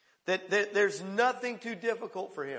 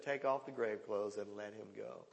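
lower, soft instruction; low quiet command; 8 semitones lower, 8 dB quieter than usual. Retold or imitated. Preacher voicing Jesus quietly telling them to unbind Lazarus. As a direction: say it low and gently.

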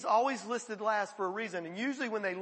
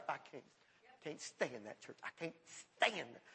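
low, soft muttering of someone fed up; a quiet, bitter grumble; muttered disgust; 6 semitones lower, 12 dB quieter than usual. Retold or imitated. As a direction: grumble it under your breath in disgust. Voicing a fed-up churchgoer.

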